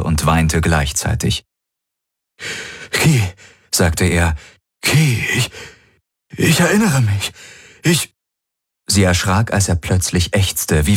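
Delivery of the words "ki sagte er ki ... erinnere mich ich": higher, broken words of memory; halting recollection. A narrator's reading. Garlin remembering Kirai, halting and higher. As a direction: stumble into remembering.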